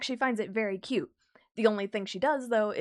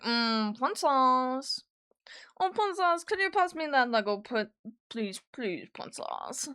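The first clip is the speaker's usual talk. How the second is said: mock Discord moderator voice; mock-serious gamer voice, slow; 3 semitones higher, slower than usual. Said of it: "got into the Discord moderator voice".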